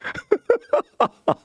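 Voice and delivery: a burst of high laughter. Real, not acted. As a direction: laugh out at the joke. Preacher laughs at own bit. (높은 웃음 터뜨리기)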